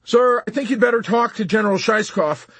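high, loud, anxious plea; urgent, nervous sergeant; nervous pleading. A narrator's reading. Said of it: "pleaded the sergeant nervously".

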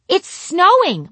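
high, slow, loud exclamation; excited exclaim. Retold or imitated. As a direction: gasp it out in delight. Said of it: "my grandma exclaimed".

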